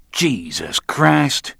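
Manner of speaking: slow, loud exasperation; exasperated. A narrator's reading. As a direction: stretch it out in disbelief and annoyance. Roland exasperated with Jesus.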